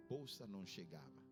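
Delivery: normal talking voice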